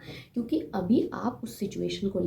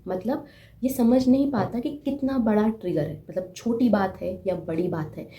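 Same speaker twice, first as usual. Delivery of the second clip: ordinary delivery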